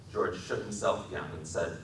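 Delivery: ordinary delivery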